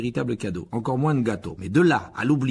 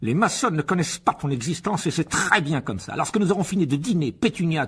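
high, excited plan swelling to a laugh; domineering pompous uncle; 10 semitones higher, 4 dB louder than usual. Acted. Uncle planning the deal and a villa, bossy turning gleeful. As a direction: lay down orders, then gloat grandly.

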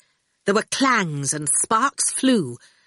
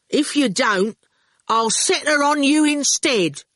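higher, raised, deliberate voice; cheeky bargainer; 7 semitones higher, 3 dB louder than usual. A narrator's reading. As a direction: lay down terms firmly. Ginger's character voice.

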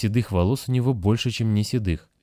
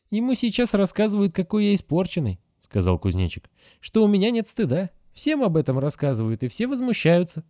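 put-on much higher child voice; hurt child; 11 semitones higher than usual. A narrator's reading. Grasshopper tells the Moose everyone is told he is spoiled, high and hurt. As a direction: complain sadly like a small boy. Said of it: "Grasshopper complains".

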